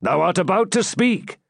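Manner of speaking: understated stern father. A narrator's reading. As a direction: cut off the coming plea firmly. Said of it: "he added sternly".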